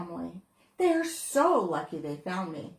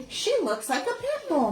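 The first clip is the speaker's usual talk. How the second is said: put-on girl's voice; 8 semitones higher, 4 dB louder than usual. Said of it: "the girl said".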